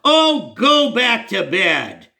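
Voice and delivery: slow, high, disgusted brush-off; "Oh, go back to bed" drawn out high and scornful; imitating the pastor's slow, scornful wave-off; disgusted dismissal. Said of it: "he waved his arm disgustedly".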